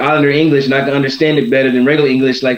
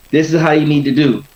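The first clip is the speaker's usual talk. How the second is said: ordinary talk, nothing marked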